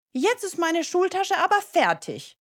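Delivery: put-on high, emphatic little-boy call. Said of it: "ruft Leo". (hoch und bestimmt gerufen)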